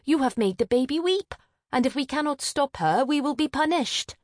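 high, vexed reproach; snapping. A narrator's reading. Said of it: "Avril snapped at Maya".

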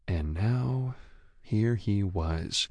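ordinary delivery, nothing marked

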